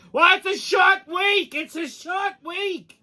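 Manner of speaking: high, slow, far-off mock yell from the back room; hammed-up distant call, stretched out. Comic retelling, shop voice calls out from the back, high slow mock shout. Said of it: "I hear his voice come from the back".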